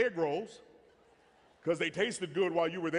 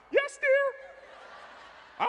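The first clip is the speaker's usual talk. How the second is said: very high, loud, meek falsetto; high squeaky voice; 5 dB louder than usual. Retold or imitated. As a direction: squeal it like a cowed husband. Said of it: "my voice got high".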